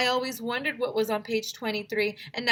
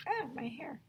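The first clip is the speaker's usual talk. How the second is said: whisper; 7 semitones higher, 10 dB quieter than usual. Acted. Child gasps in whisper.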